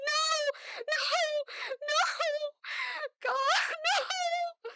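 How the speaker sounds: high, loud, sobbing scream; desperate shout. Acted. Mother screaming at Vashnis to spare her daughter. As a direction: scream for mercy through tears. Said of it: "She shouted in Fuchuran".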